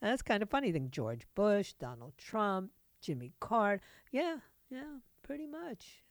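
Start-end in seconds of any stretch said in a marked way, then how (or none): 4.1-6.0 high, soft sarcastic aside; mock agreement. Radio host mocking a listener's theory, sarcastic soft voice. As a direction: toss it off in a mock little voice.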